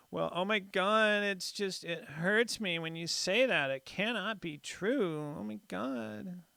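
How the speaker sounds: high, breathy mock-offended whine; liberal snowflake voice. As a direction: clutch your pearls, wounded and aghast. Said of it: "My best liberal snowflake voice".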